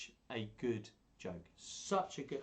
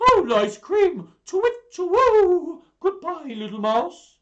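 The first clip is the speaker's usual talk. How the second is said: shrieking much higher and louder; terrified owl; 19 dB louder, slower than usual. Acted. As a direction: hoot in panic and flee. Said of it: "do all the voices".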